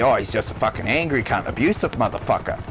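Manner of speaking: low, offhand imitation of a judging viewer; mock TV viewer. Speaker mimics viewers judging an angry TV chef, low mock-viewer voice. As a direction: toss off the viewers' verdict, pitched well down. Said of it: "everybody sees him on T V".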